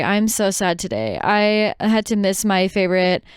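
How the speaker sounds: usual speaking voice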